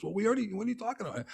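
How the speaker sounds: hammed-up disbelief from the boss; baffled executive, fading out; mock exasperated president. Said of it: "And he goes".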